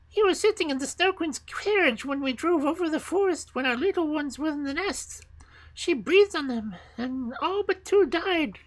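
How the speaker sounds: very high-pitched bird voice; squeaky little bird bringing news; wood pigeons. Acted. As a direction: tell it like a high, piping pigeon.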